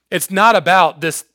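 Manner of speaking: normal talking voice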